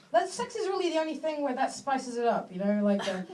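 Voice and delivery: usual speaking voice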